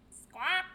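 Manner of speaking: loud squawking bird cry; gull squawk. Acted.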